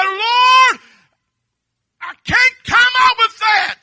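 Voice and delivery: high, loud anguished plea to the Lord; retold tearful prayer. Pastor reliving his desk prayer, a high, loud desperate cry. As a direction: cry it up to heaven in despair. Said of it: "and I was crying".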